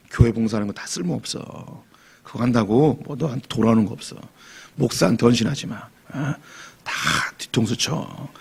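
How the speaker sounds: lightly voiced, hushed grumble of a burned-out believer; bitter muttered advice. Disillusioned churchgoer warns others quietly, bitter. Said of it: "주변에 다니면서 뭐라고 얘기하냐면".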